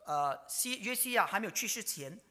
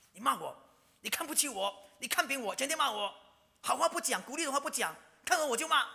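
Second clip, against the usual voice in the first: building mock grievance; 7 semitones higher than usual. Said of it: "很生气".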